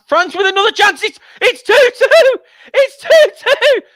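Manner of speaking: roaring the goal, pitch soaring; excited goal call. Real, not acted. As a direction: scream the equaliser like a commentator losing it. Goal goes in, commentator erupts.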